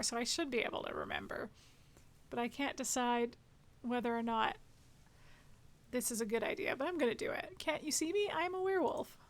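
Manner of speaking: usual speaking voice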